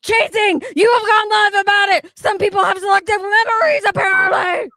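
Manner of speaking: high, loud mock yell of a caps comment; mock shouting. Streamer reads a viewer's all-caps comment to co-host, shouting. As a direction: bellow it like a comment in capitals.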